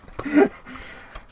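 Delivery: high, airless burst of laughter; breathy laugh. Real, not acted. Laughing at the hammer joke.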